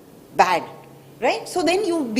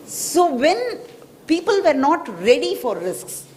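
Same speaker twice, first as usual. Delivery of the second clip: normal talking voice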